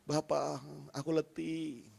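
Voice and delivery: tired whispered-down prayer to God; quiet prayer. Acted. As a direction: pray it close, fading to almost nothing. Quoting intimate prayer.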